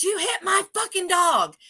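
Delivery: high shouted accusation; furious accusing shout; angry yell. Retold or imitated. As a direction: yell it at her like an angry stranger. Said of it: "And he goes".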